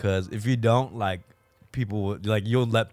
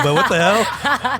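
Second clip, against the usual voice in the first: laughing disbelief; 9 semitones higher, 5 dB louder, slower than usual. Real, not acted. Laughing disbelief at friend's remark.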